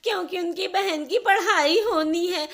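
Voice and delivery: high, loud whining rant breaking into sobs; tearful loud complaint. Acted. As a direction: pour out the grievance, crying, slowing at the end. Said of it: "जोर-जोर से रोने लगती है".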